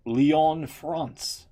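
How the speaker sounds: a quick put-on French accent on one word; French accent attempt. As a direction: say it like a Frenchman, half joking. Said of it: "That's my attempt at a French impression there".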